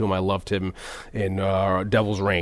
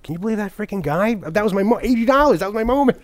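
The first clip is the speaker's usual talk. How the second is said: higher, louder outraged wife; wife fuming after the interruption; indignant rant; 10 semitones higher, 3 dB louder than usual. Retold or imitated. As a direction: vent at the rude fan. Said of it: "And she was like".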